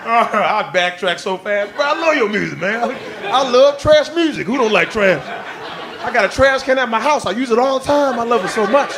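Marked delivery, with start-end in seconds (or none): 1.7-8.9 fake flattery. Sucking up to rapper.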